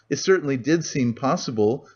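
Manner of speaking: usual speaking voice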